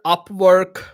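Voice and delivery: normal talking voice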